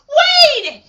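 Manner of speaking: high, loud, hammed-up cry of a friend's name; mock-horrified yell; alarmed shout.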